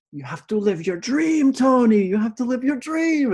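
high, loud mock shout of the stranger's message; hammed-up shouted prophecy. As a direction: belt it out like an overzealous prophet.

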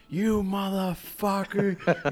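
slow, high, drawn-out cursing; imitated anger. Barber mimicking his startled coworker after the prank, high mock anger. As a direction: stretch the insults in mock outrage. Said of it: "he was angry".

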